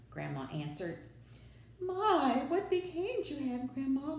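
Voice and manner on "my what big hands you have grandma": very high, wailing groan; groaning wolf. A narrator's reading. Caught wolf sees Grandma's hands, high groan. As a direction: groan it high, leaning on the first word. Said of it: "the wolf groaned".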